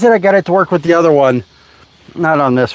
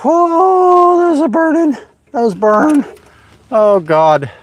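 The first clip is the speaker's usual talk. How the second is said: high pained cry, much higher; real pain; 13 semitones higher, slower than usual. Real, not acted. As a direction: yelp as the metal burns. Burned by hot metal shavings.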